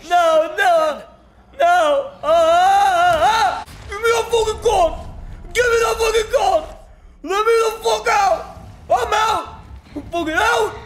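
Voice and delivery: high, frantic yelling; panicked screaming. Real, not acted. Player begging to be let out, panicked screaming. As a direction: scream in a panic to get out, pitch held high.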